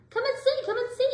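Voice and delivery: high, loud excited call; shouting. A narrator's reading. Jack calls his sisters to see the snow, high and loud. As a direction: call the family to the window, thrilled. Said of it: "he shouted".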